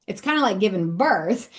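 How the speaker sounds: ordinary talk, nothing marked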